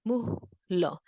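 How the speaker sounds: muslo said muhlo, the S just a soft breath out; breathy S in muslo. Shown as a demonstration. Teacher shows students the Puerto Rican S in muslo, breathed out. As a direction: let the S in muslo become a puff of air. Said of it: "A little bit of a breath out for the S".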